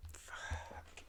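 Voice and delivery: real voiceless swear under the breath; hushed, frustrated whisper; whispered curse. Real, not acted. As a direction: whisper it to yourself after a flub. Swears after flubbing a line.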